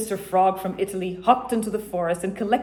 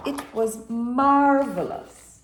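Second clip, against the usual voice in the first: marveling; 4 semitones higher, 4 dB louder, slower than usual. A narrator's reading. Narrator marvels.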